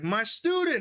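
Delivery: high, delighted retold greeting; excited greeting. Fan spotting the bandmate she taught, excited. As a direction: act out spotting a favorite pupil.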